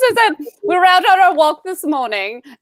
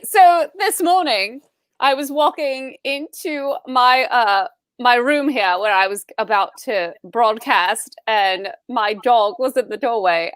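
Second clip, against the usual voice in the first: ordinary delivery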